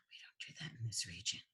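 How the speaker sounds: breathy whispered aside. Real, not acted. Teacher whispering an aside to the class. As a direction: drop to a secret whisper. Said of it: "whispered aside".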